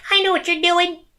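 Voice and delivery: put-on very high-pitched kid's squeak; exaggerated little-boy pitch; high young voice. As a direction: squeak it like your much younger self. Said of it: "a little higher at this time 'cause I'm young".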